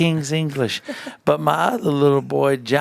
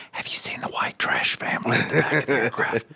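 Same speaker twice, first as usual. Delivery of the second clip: hushed, softer mock gossip; gossipy whisper; 4 semitones lower, 6 dB quieter than usual. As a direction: whisper it like a nosy passenger. Passengers gossiping about the family.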